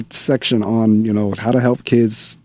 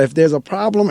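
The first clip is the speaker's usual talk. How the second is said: ordinary delivery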